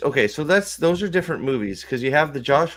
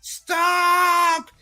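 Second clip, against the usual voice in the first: very high, long drawn-out mock scream; Joy's desperate cry. Joy bursting out of Riley's ear, desperate high cry. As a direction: cry out as if halting a jumper. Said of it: "She's like".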